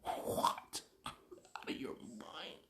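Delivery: unvoiced, slow whisper of disbelief; incredulous whisper. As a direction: breathe it out in stunned disbelief. Disbelief after dad's beating.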